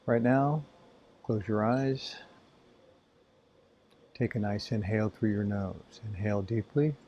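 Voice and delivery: calm breathing instructions with long pauses; spacious, measured meditation voice; guided meditation. Acted. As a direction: guide them gently through each breath.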